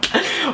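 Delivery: high burst of real laughter. Real, not acted. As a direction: giggle at your own bit. Laughing at own silly voice.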